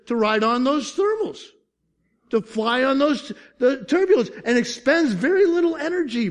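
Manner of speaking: high, energised preaching; fervent preaching. Real, not acted. Pastor explaining the eagle riding thermals, fervently.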